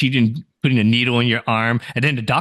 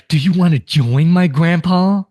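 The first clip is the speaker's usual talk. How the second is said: higher, louder mock outrage; playfully threaten, loud and high; 7 semitones higher, 6 dB louder than usual. Acting the grieving friend's threat.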